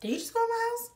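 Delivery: high-pitched, incredulous question; retold disbelief. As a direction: pitch way up, as if baffled. Startled reaction to neighbor entering.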